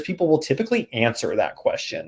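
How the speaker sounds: ordinary talk, nothing marked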